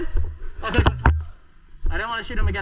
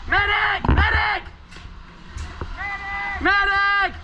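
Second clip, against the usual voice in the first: man down shout; 8 semitones higher, much slower than usual. Real, not acted. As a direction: yell for the medic across the field. Hit player calling for medic.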